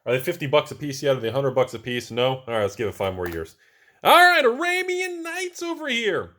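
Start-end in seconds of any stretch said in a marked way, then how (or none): 4.0-6.3 very high, drawn-out silly announcing voice; hammed-up announcement. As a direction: sing out the section title high, loud and slow. Hammed-up announcement of next card section.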